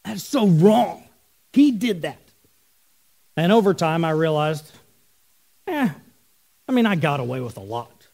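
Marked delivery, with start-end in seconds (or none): 0.0-2.2 put-on slow, put-upon little kid voice; whiny wronged kid. A kid protesting a punishment he didn't earn, whining slowly. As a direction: play the unfairly punished kid, drawn out. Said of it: "and I was like".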